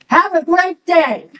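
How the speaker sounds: high, slow sing-out farewell, imitated; teasing send-off. Laughing officer sends the woman off, teasingly. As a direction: call it after someone, stretched out and teasing.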